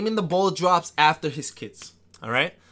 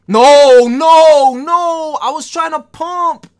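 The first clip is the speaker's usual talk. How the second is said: loud high "no, no, no" in dismay; frustrated yell; 10 semitones higher, 14 dB louder, much slower than usual. Real, not acted. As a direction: shout it out as the play falls apart. Frustrated outburst at missed play.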